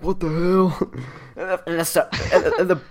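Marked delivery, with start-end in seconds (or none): 0.0-0.8 mild mock-shocked exclamation; incredulous, pitched-up reaction; classmates' disbelief. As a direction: imitate startled classmates, raised and louder. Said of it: "people sit next to me are like".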